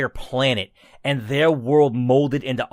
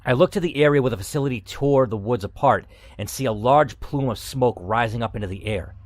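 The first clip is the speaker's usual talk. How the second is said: steady, even read-aloud narration; story narration; 4 semitones lower, 4 dB quieter than usual. A narrator's reading. Reading the game's final chapter aloud to viewers, calm narration.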